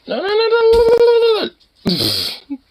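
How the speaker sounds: very high, loud rapid-fire no-no-no; urgent scolding. Real, not acted. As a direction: stop the dog with a loud high squeal. Stopping the dog.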